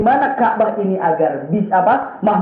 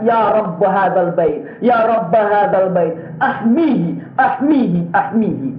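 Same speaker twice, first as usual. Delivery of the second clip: slow, pleading chanted prayer; slower than usual. Retold or imitated. Preacher voicing Abdul Muttalib praying at the Ka'bah, slow and pleading. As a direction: plead slowly to God.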